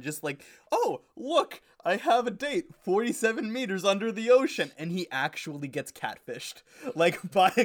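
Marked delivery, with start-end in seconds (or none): 0.7-4.7 high-pitched naive excitement; mock-excited, high reading of a message; delighted online dater. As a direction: play a dude thrilled about his date.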